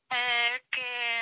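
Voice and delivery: ordinary talk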